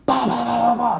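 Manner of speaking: loud, high "ba-ba-ba" banging; rapid vocal knocking sound effect; imitated pounding. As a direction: mimic a fist pounding the door. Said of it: "starts pounding on my door".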